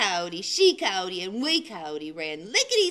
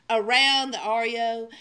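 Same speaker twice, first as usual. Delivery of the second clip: plain reading voice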